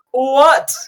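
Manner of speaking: high, slow, drawn-out innocent protest; put-on high voice, mock-offended; Karul protesting. Game master voices Karul caught out, high innocent protest. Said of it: "Karul is like".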